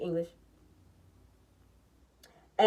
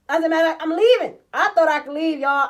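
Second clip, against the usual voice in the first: louder and higher, slowing to a stomp; heated; 7 semitones higher, 8 dB louder than usual. Retold or imitated. Telling viewers how she walked out, loud and heated. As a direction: drive it like storming out. Storming out.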